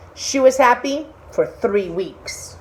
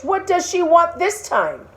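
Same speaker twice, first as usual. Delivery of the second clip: high, weary fish voice; a fish losing patience; exasperated fish; 9 semitones higher than usual. Acted.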